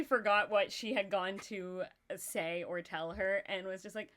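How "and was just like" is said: ordinary talk, nothing marked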